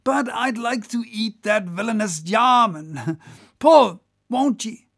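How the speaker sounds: high, loud goading of the rowers; rousing cry. Acted. Whaler to his crew racing the German boat, rousing cry. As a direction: whip up the crew, swelling to shouts. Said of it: "cried Stubb to his crew".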